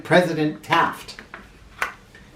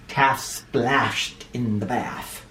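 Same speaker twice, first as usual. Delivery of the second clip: ordinary delivery, nothing marked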